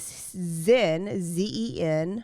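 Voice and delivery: slowly spelling out the promo code; slow spelled code. Shown as a demonstration. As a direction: spell it out clearly for listeners.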